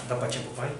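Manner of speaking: ordinary talk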